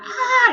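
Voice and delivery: a sharp, high-pitched fan shriek, loud; imitated fan scream. Fans shrieking at the celebrity, a loud high imitated scream. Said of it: "you know, screaming and stuff like that".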